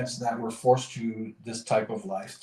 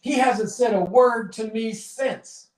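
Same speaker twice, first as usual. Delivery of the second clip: much higher, louder angry shout; a cheated bartender shouting, much higher and louder; furious bartender; 13 semitones higher, 6 dB louder than usual. Retold or imitated. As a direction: yell at the man who cheated you. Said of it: "walks right over to this guy and says".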